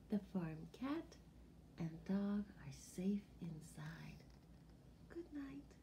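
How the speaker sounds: put-on soft, hushed storytelling for sleepy kids; bedtime whisper. Telling children the animals sleep, a hushed whisper. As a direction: lull them toward sleep.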